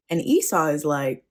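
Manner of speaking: ordinary delivery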